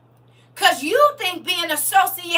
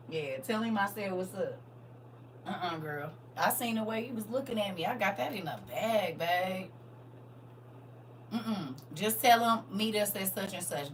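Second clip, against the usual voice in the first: low flirty voice; 7 semitones lower, 12 dB quieter than usual. Acted. Voicing Oholah's flirty phone chat.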